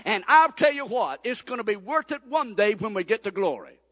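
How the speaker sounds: loud, ringing sermon climax; full-force preaching. Real, not acted. Pastor urging the church to stay faithful, loud preaching. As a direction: drive it home to the pews.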